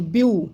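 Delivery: "ब्यू" said alone as one separate syllable; syllable demonstration. Shown as a demonstration. Teacher showing children the syllables of ब्यूटीफुल, "ब्यू" pronounced on its own. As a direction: break off "ब्यू" as a single mouth-opening. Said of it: "बोलते समय मुंह को दो से ज़्यादा बार खोलना".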